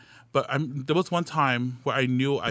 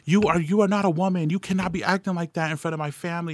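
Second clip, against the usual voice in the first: higher-pitched, indignant retold scolding; raised-pitch mock outrage, drawing out the first words; mom's angry scolding; 6 semitones higher, 3 dB louder than usual. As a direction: imitate a mother grilling her child. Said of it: "my mom just starts grilling me".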